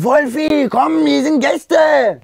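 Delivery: long, drawn-out high call through the door; a slow, high yell for a friend inside. Retold or imitated. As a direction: stretch it out slowly, calling someone to come out. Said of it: "Er so".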